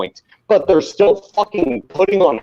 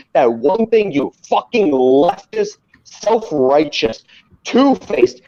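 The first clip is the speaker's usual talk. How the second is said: ordinary talk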